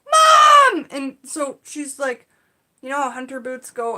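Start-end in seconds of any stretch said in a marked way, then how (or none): 0.1-0.9 high, loud imitated yell of a kid; daughter yelling Mom. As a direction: belt it like a child calling for help.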